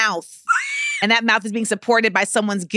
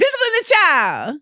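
mock outrage; 8 semitones higher than usual. Repeats outraged demand.

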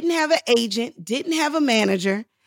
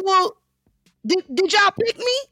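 high, stammered question; retold flustered asking; incredulous question; 10 semitones higher than usual. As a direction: ask it high, caught off guard. Acts anxious question to casters.

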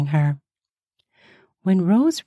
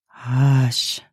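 low, gentle male hush; man's hush; 6 semitones lower than usual. A narrator's reading. Master hushes Rose.

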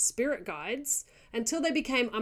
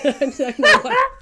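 cracking up helplessly, louder and higher; laughing; 5 semitones higher, 11 dB louder than usual. Real, not acted. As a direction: lose it laughing mid-sentence. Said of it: "number seven's just making me laugh".

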